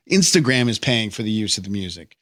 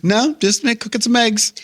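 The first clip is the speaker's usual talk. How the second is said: higher, chirpy relaxed reply; casual victim; 10 semitones higher than usual. Retold or imitated. Victim on the phone, higher casual breezy reply. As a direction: play it breezy, nothing's wrong.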